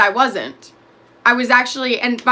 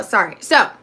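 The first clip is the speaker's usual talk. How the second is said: ordinary talk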